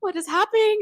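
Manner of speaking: nervous traveller reliving airport scare, panicked and loud; retold panic. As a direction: relive the moment you thought someone planted something. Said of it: "I was like".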